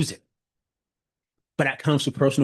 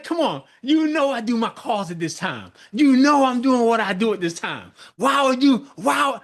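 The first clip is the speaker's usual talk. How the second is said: exasperated scolding; 9 semitones higher than usual. Retold or imitated. To kids interrupting the call, high fed-up scolding. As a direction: scold like they keep doing it on purpose. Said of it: "I'm like".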